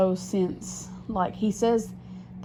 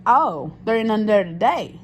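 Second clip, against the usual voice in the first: slow, loud, higher mocking repeat of his words; mocking echo; 5 semitones higher, 6 dB louder than usual. She mocks her boyfriend's excuse, slow and loud. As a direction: parrot his line back with scorn.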